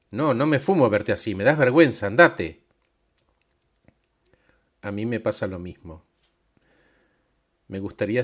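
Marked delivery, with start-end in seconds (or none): 0.1-2.6 louder and louder, disgusted dismissal; contemptuous outburst. Acted. Demanding self tells Pedro to go, loud and disgusted. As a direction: drive him away with scorn.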